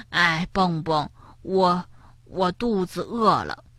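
soft, halting confession; sheepish. A narrator's reading. Hungry Tiaotiao to Bengbeng, sheepish. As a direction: trail it out quietly and hesitantly. Hungry, hesitant admission after stomach rumbles.

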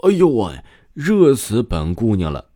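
high, loud huffy complaint about the heat; exasperated exclaim. Acted. Girl complaining to herself about the heat, huffy and loud. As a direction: fan yourself and groan it out.

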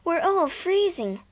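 pleading. Acted. Child pleading with mother.

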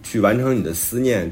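ordinary talk, nothing marked

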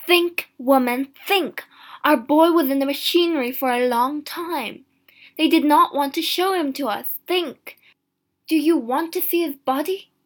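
exasperated, horrified husband; forceful pleading. A narrator's reading. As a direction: hammer each appeal to make her think.

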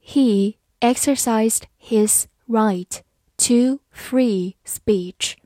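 slow, clear, word by word; slow reading. Shown as a demonstration. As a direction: read it slowly for learners to follow. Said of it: "好，慢慢来读". (慢速清晰朗读)

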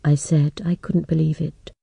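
plain reading voice

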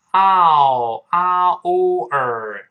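"嗷", then "啊、哦、儿" held loud and separately; sounds broken down. Shown as a demonstration. Teacher breaks flower into sounds, loud drawn-out vowels. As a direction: sustain each vowel, gliding to a curled "儿". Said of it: "这么一个声音".

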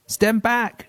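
sudden high call of stand back; father's warning. A narrator's reading. Father warning sons from the squirting lemons, high and sharp. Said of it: "he said".

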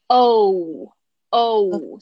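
O, O drawn out as a full diphthong. Shown as a demonstration. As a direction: glide the O fully, oh-oo. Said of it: "双元音". (完整双元音“O”)

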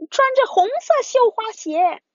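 high, loud squeaky monster voice. Acted. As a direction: squeal out the answer. Monsters answer.

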